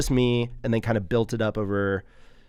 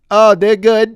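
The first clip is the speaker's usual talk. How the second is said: smug date guy; 10 semitones higher, 13 dB louder, slower than usual. Pretend. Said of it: "I haven't done improv".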